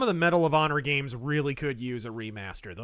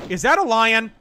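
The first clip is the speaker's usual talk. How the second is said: high loud panic at a lion; panicked yelling; 10 semitones higher, 12 dB louder than usual. Real, not acted. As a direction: scream as the lion charges. Lion attacks in game.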